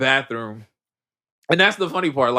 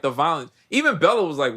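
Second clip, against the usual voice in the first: ordinary delivery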